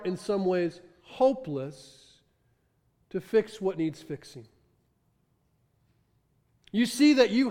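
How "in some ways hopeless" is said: ordinary talk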